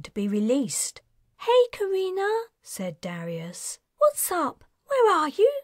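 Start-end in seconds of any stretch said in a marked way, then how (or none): 1.4-2.4 much higher, light friendly voice; young man Darius, cheerful. A narrator's reading. Darius speaks in her head. 4.0-5.5 much higher, light friendly voice; young man Darius, cheerful. A narrator's reading.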